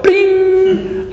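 very high sung ding; ping like a bulb switching on; light bulb bing. Acted. Preacher sounding a light bulb going on. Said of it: "the little light bulb".

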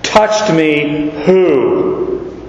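very slow, deliberate "Touched me... who?"; insistent. Acted. Christ rearranges the question, not letting go, very slow. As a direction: draw out each word, refusing to let it go. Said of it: "he's not gonna let this go".

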